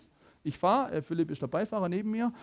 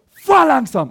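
higher, much louder sudden yell; sudden shout; 8 semitones higher, 13 dB louder, slower than usual. Retold or imitated. Passenger startles driver with a loud shout. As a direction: bark it out so the driver jumps. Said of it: "Und ich bin zusammengezuckt".

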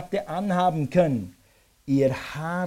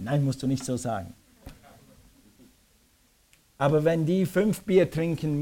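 normal talking voice

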